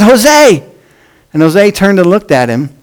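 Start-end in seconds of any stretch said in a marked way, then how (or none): 0.0-0.6 high, loud call of a name; a shouted name. Retold or imitated. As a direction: call his name again across the base. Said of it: "Bobby called out again".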